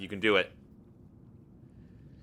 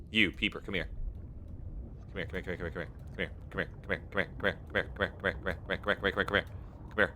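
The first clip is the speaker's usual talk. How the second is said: soft coaxing; 2 semitones lower, 5 dB quieter, much faster than usual. Real, not acted. Coaxing a fish to come.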